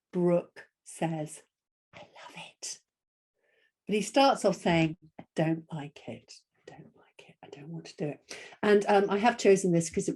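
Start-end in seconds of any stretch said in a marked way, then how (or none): 1.9-2.8 hushed, delighted whisper; voiceless whispered story line. Acted. Reading the story's surprise line softly. 5.2-8.4 hushed, fading into a whisper; small reluctant whisper; whispered reluctance. Acted. Voices reluctant character in story.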